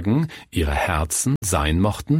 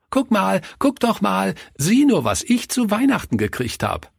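put-on high-pitched, gleeful child showing off a present; excited child; 12 semitones higher than usual. A narrator's reading. Günther imagines boasting about his Christmas present, excited child. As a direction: squeal it high like a thrilled little boy.

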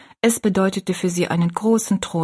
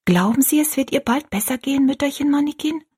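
anxious hope; 7 semitones higher than usual. A narrator's reading. Rosalie anxious hopeful question.

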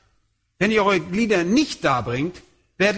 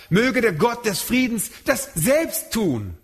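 selbst stretched and stressed, slower; 5 semitones higher than usual. Shown as a demonstration. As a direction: lean into selbst slowly. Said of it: "emphatisch, also zur Betonung".

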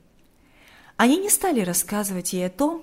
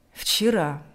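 subdued, sorrowful answer; sad; 3 semitones lower than usual. A narrator's reading. Father answers his son about the birth, sadly. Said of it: "грустно ответил отец".

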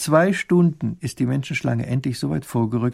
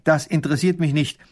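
higher, quick, stern rebuff; stern answer; 6 semitones higher, 4 dB louder, faster than usual. A narrator's reading. Baron rebuffing the widow's plea, stern. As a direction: cut the plea off strictly and briskly. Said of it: "antwortet streng".

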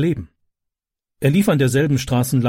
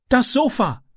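put-on high, urgent boy's voice; Ram calling out; 11 semitones higher than usual. A narrator's reading. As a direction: shout the alarm as young Ram. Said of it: "rief er".

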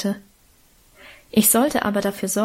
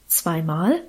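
astonished; 5 semitones lower than usual. A narrator's reading. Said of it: "fragte die Frau erstaunt".

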